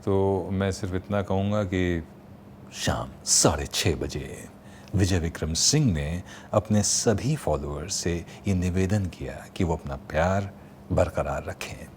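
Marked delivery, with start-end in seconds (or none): 2.7-11.9 Bigg Boss announcer voice. Shown as a demonstration. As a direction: announce it like the unseen voice of the house. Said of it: "आपके अंदाज में".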